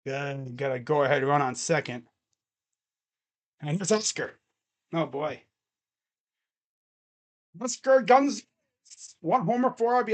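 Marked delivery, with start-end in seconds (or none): none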